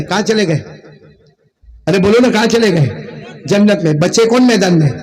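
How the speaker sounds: normal talking voice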